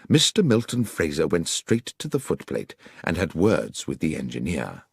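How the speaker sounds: plain reading voice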